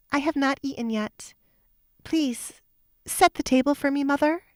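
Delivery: put-on defiant young woman. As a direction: pleasant on the surface, an order underneath. Alessandra orders her stepmother.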